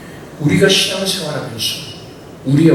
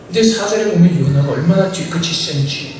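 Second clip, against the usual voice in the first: ordinary delivery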